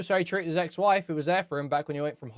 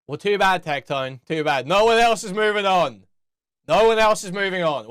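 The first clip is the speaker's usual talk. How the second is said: loud, scornful refusal; angry retort; 5 dB louder than usual. Real, not acted. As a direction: shout him down with contempt. Streamer angrily rejects moving on.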